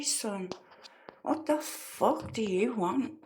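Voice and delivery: ordinary talk, nothing marked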